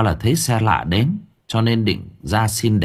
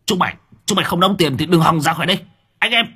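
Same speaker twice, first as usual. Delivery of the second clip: high, fast gangster threat; acted thug's threat, pitched high; aggressive threat; 9 semitones higher, faster than usual. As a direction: rattle out a high-pitched demand to pay up. Gang leader threatens the truck crew.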